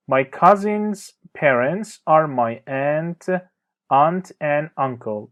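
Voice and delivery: very slow, careful teacher reading; slow clear example. Shown as a demonstration. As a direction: spell each word out slowly for learners. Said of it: "teacher reads example sentence". (جملهٔ نمونه را خیلی آهسته و شمرده بخوان)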